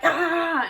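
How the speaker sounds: short high imitated "ah"; hammed-up frantic noise; mock rough-scrubbing cry. Shown as a demonstration. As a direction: act out an over-vigorous scrub with a high cry. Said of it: "I'm not like".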